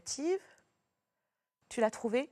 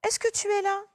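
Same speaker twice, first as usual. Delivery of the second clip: much higher, louder call; calling question; 10 semitones higher, 5 dB louder than usual. Shown as a demonstration. Someone calling to find a person, high and loud. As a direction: call out to check if someone's there. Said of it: "je pose une question".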